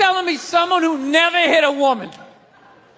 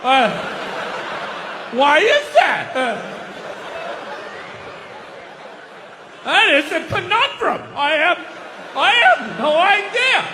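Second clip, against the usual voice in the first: dumbfounded men. Retold or imitated. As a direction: fake confusion, stalling. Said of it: "like, dumbfounded".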